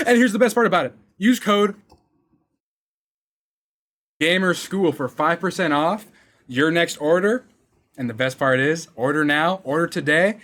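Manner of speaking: loud hyped sponsor read. Acted. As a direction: sell it like a hype announcer.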